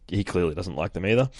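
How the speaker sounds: ordinary talk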